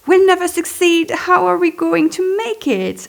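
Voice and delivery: high, loud panicked wail; panicked cry. Retold or imitated. Panicking over too many books, loud high panicked cry. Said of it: "I cried".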